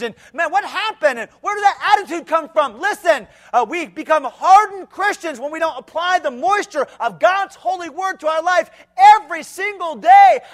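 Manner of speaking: high, loud, shouted rebuke; preaching at full force. Acted. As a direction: shake the youth awake. Rebuking the youth.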